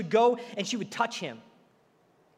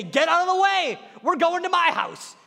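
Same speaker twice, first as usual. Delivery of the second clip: louder, higher, pushing through a crowd; mock demand; 7 semitones higher, 5 dB louder than usual. Jairus imagined ordering the crowd aside, raised and higher. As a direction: clear the way, now.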